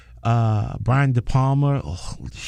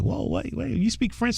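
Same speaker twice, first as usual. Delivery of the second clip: high, softer mock double take; surprised vagrant; 10 semitones higher, 6 dB quieter than usual. Vagrant amazed the slacker speaks French, high and soft. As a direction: play a stunned vagrant catching on.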